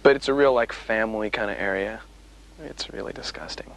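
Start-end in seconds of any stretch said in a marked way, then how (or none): 2.6-3.7 whispered aside. Real, not acted. Muttered aside.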